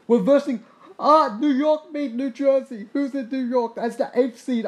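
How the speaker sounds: loud, high, slowed-down excitement; thrilled gamer seeing a rival knocked out, loud and high; excited disbelief. Real, not acted. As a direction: blurt out the upset news. Upset in the playoff bracket.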